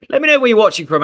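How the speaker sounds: called out loud and high to the chat; a bright, loud call to the viewers. Real, not acted. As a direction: shout the invitation to everyone watching. Streamer calls out to viewers.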